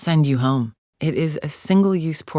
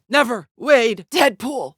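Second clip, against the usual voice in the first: high, raised-voice refusal; forceful and pitched up; angry defiance; 9 semitones higher, 4 dB louder, slower than usual. Acted. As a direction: spit the name at an enemy.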